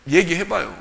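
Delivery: ordinary talk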